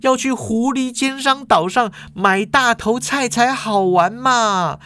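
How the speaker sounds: put-on high-pitched bratty young girl voice; girl barging in, scoffing at the men's console debate; Ye Feng. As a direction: whine it up high like a spoiled kid. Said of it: "叶枫突然就走进来说".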